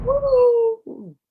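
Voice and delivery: high, loud "woo" cheer; whoop. Pretend. Host cheers the segment title, high excited whoop.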